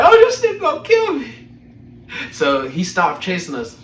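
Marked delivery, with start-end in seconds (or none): 0.0-1.3 high, loud, laughing mock terror; laughing panic. Big kid retelling being chased, in laughing high panic. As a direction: shriek it like you're running for your life. Fleeing the bum, retold panic.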